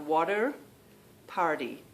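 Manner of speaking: American "water, party" with D for T; American accent. Retold or imitated. Summing up for viewers, American D in both words. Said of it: "the T changes to a D".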